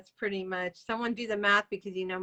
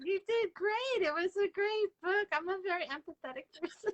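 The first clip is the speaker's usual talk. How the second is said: higher, teary reassurance; tearful comfort; 10 semitones higher than usual. Real, not acted. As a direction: comfort her while welling up. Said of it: "I'm starting to cry".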